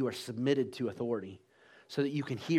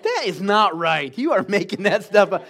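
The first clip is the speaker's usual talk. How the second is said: louder, emphatic mock protest; indignant challenge; 5 semitones higher, 8 dB louder than usual. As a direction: call out her bluff, loud and insistent. Acted challenge to sister-in-law's inventions.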